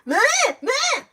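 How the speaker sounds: high mocking whine sound; mock whimper. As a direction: parody her whimpering. Mother mocks the child's crying.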